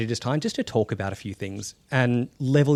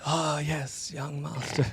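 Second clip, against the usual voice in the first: deferential servant; 4 semitones higher, 8 dB quieter, slower than usual. Acted. Game master voices a servant.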